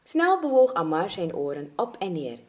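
plain reading voice

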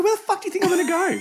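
high, loud, fast comeback; indignant retort. Retold or imitated. Acts out her indignant retort.

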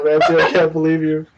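ordinary delivery

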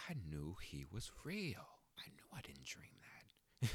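hushed, unvoiced awed whisper. Old classmates seeing him on Facebook, in a hushed, awed whisper. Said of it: "Like, or like".

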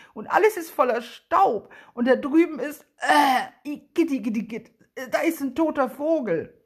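loud, high cries of disgust; boy in the mill shouting in disgust at spiders and dust. Acted. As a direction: squeal out the grossness, igitt. Boy disgusted inside dusty mill.

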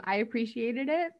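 ordinary delivery

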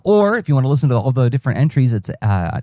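ordinary talk

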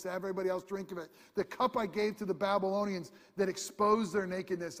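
loud, high voice of God in the sermon; preaching at full force. Acted. Preacher voicing God's warning to the people, loud and high. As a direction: thunder it out for the congregation.

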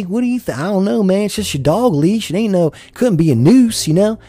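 smart-ass twang impression. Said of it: "being a smart ass".

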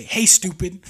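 loud call to a deer; retold yell. Hunter calling to an unbothered buck, retold yell. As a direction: re-enact hollering at a stubborn animal. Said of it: "I just kept yelling".

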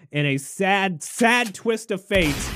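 high, drawn-out mock sorrow; wailing pretend grief, slowed right down; mock lament. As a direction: stretch it out high, hamming up the tragedy. Mock mourning for escaped monkeys.

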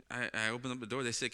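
normal talking voice

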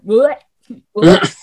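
loud mock gag, "bleh"; disgusted retching. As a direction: imitate a gut reaction of revulsion. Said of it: "visceral reactions to things".

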